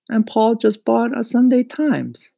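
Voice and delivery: put-on high, loud boyish character voice; eager boy Mario. A narrator's reading. Mario reports his sales to Papa, high and eager.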